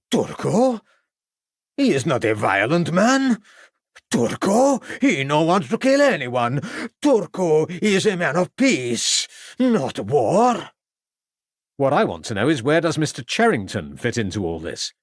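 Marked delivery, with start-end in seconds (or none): 0.1-10.7 pleading defence. Acted. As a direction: insist he is a man of peace. Violinist defends himself to police.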